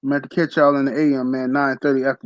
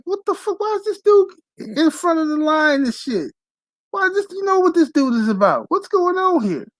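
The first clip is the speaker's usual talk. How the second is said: baffled reaction. Retold or imitated. Acted baffled viewer reaction.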